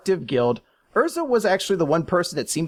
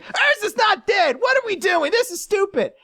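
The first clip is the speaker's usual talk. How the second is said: Natsu. Retold or imitated. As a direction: burst in yelling in high-pitched denial. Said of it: "slams his way in".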